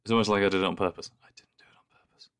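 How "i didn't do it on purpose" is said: whisper. Real, not acted. Whispered aside after joke.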